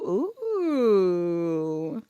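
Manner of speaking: long drawn-out ooh, sliding down; impressed ooh. Real, not acted. Reader relishing a vase description, long impressed ooh. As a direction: let out a long, savouring ooh. Savours the description just read aloud.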